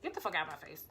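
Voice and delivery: low, soft menacing order; cold threat. Retold or imitated. Telling boyfriend off at the door, low cold threat. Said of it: "I was like".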